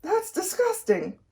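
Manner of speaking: high, slow grossed-out drawl; disgusted, tongue out. A narrator's reading. Leslie sticking out her tongue at the sandwich, disgusted. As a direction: draw it out high like a disgusted girl. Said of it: "sticking out her tongue".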